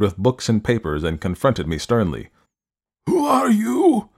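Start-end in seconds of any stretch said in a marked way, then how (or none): 3.1-4.1 put-on high, slow, stern old man voice; imperious elderly recluse, demanding. A narrator's reading.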